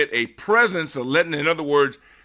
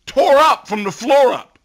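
higher and louder, hammering the loss; emphatic outburst; 9 semitones higher, 7 dB louder than usual. Real, not acted. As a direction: bang it out in frustration. Ranting about the blowout loss.

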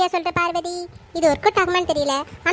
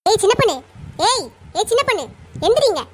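raised, higher voice yelling to wake her; calling out; 4 semitones higher than usual. Acted. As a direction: shout her awake from the doorway. Mother-in-law wakes sleeping daughter-in-law. (உரக்க அதட்டி எழுப்புதல்)

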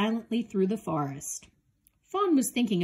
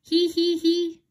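high, loud hee hee hee giggle; a mocking little fawn giggle; teasing giggle; 9 semitones higher, 7 dB louder than usual. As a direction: giggle at the calf's fall, up high. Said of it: "Calf heard laughing".

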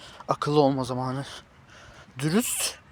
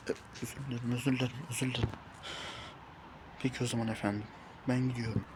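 much softer, meek and lower; sheepish apology; 4 semitones lower, 13 dB quieter, much faster than usual. Acted. Caught talking to himself, apologizing meekly to the stranger. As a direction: shrink away apologizing.

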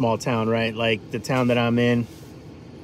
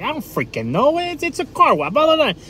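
much higher, squeaky mock rant; hammed-up grumbling worker; grumpy car wash guy; 14 semitones higher than usual. Car wash guy grumbling at customer, hammed-up rant. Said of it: "just cursing up a storm".

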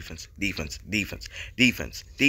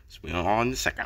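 normal talking voice

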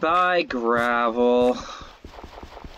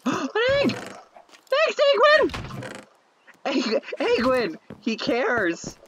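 much higher, squealing thanks to the egg; delighted; 13 semitones higher, much slower than usual. Real, not acted. Player thanking the egg he named Eggwin, high delight. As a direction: gush over the egg like a friend.

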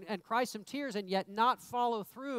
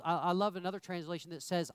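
ordinary talk

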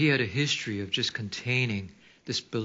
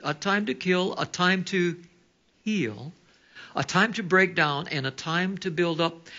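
scripture reading; 4 semitones higher than usual. Acted. Reads Ecclesiastes aloud.

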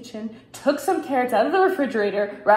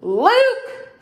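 loud, high call rising on the name; 10 semitones higher, 6 dB louder than usual. A narrator's reading. Father calling Luke for help, loud. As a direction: summon the boy from across the yard. Father calls his son.

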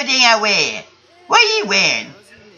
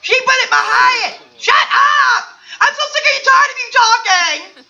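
mock furious shout; 11 semitones higher than usual. Yelling at Nicole who put it in his head, tantrum. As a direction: scream it, hammed-up tantrum.

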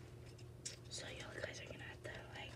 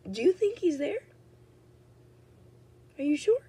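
high squeaky voice filter, louder; 10 semitones higher, 17 dB louder than usual. Shown as a demonstration. Vlogger imitating the TikTok voice filter, high and squeaky. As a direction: play it like a high chipmunk-like filter. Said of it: "I sound like that one filter".

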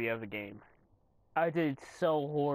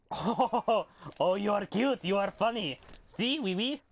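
put-on teasing baby talk, raised and louder; mock cute taunt; 5 semitones higher, 3 dB louder than usual. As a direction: coo at the enemy like at a puppy. Mock-cute taunt at enemy player.

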